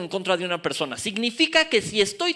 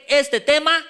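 high, loud prompt to the crowd; raised voice for everyone to repeat; loud call-out; 7 semitones higher, 6 dB louder, slower than usual. Shown as a demonstration. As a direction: lead the congregation loudly. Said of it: "que se oiga fuerte".

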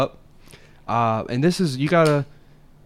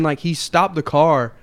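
ordinary delivery

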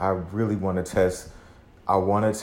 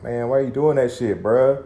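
higher, louder scolding tone as his cautious side; conservative inner voice; 7 semitones higher, 8 dB louder than usual. Retold or imitated. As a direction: scold yourself like a worried big brother. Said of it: "the conservative one is like".

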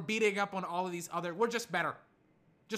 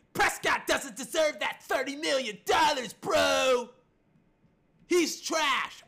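loud, high mock-fan shouting; ranting Cowboys fan; 5 semitones higher, 6 dB louder, slower than usual. Mimicking Cowboys fans attacking Prescott, shouted mock outrage. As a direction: yell it like an outraged bro. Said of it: "a lot of different Cowboy fans saying".

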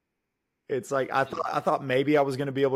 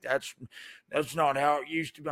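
put-on old man; 2 semitones higher than usual. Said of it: "eighty-year-old Republican coming on in me".

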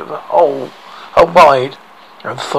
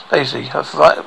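ordinary delivery

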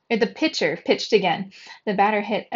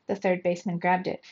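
ordinary delivery, nothing marked

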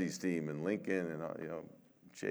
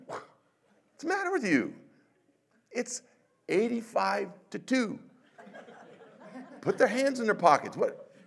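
high, louder laughing mock shout at the coach; mock yell at coach; 12 semitones higher, 3 dB louder than usual. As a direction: call out to the coach in half-joking outrage. Yelling at coach during game.

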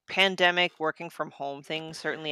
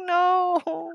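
high mock refusal; 12 semitones higher than usual. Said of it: "I was like".

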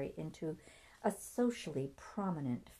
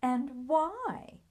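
high, loud, shrill challenge; shrill, indignant question; 10 semitones higher, 7 dB louder than usual. A narrator's reading. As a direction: throw it back in a piercing pitch. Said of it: "her sister shrilled".

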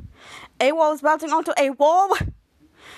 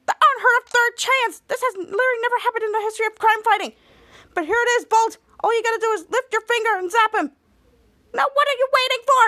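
high, rapid, incredulous urging; frantic exhortation; 8 semitones higher, 3 dB louder than usual. Acted. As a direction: rush it out, desperate for action. Captain urges at third chance.

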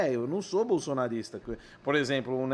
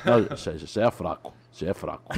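voz bem grave e seca; 12 semitones lower than usual. Acted. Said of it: "que imita o [name]".

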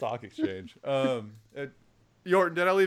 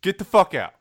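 angry order; 7 semitones higher, 8 dB louder than usual. Retold or imitated. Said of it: "he goes".

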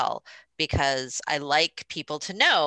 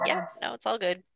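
ordinary talk, nothing marked

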